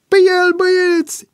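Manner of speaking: very high, loud strained yell; grunted rallying cry. A narrator's reading. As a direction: set the gang on him. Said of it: "icni un altul". (strigăt icnit de atac)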